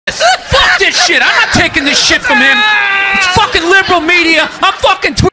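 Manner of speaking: high-pitched comic rant; mock angry outburst. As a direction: act out an indignant refusal. Acts out Trump refusing the advice.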